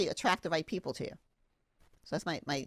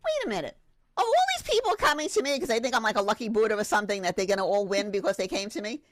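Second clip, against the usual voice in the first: sudden realisation at her own luck; excited realisation; 4 semitones higher, 6 dB louder than usual. Retold or imitated. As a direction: piece it together in a rush. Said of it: "then I was like".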